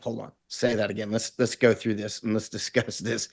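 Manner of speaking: hushed, eager retold reply; quiet aside. To the old colonel in the coffee shop, quietly eager.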